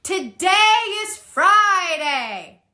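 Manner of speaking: slow, loud, calling to a big crowd; chest-voice call, slow and strong; projected announcement. Shown as a demonstration. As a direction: announce it to a full hall. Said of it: "announce that to your large group of people".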